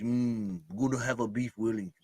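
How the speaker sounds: quiet, prim mock British accent; posh British accent. Mocking British diners, soft posh British accent. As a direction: order it like a proper English lady, British accent. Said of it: "the Queen's language".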